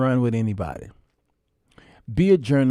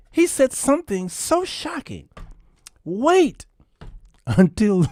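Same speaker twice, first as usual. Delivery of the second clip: mock dramatic hype; 9 semitones higher than usual.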